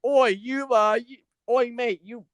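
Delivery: high, mock British scold with a fake accent. Co-host mocks a wrestler calling out her rival in British accent. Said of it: "[name] shoving in his, uh, his accent talents".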